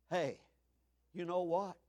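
ordinary delivery

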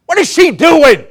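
a scoffing onlooker's high, loud cry; preacher playing a scoffer mocking the woman at Jesus' feet, loud and high.

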